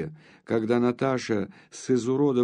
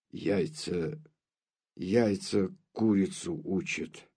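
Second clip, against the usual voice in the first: put-on lower, softer, tearful old count; through happy tears; 4 semitones lower, 4 dB quieter, slower than usual. A narrator's reading. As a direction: say it gently, choked with joy. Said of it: "Сквозь счастливые слёзы проговорил граф".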